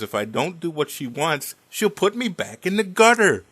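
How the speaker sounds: imitated dad, building to a loud, high cry; acted protest. Dad explaining to son why he obeys mother, protesting. Dad's exasperated punchline about mother.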